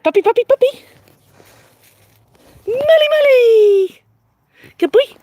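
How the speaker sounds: excited calling. Real, not acted. Trainer calling her puppy over, excited and high with a laugh. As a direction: call the puppy with glee.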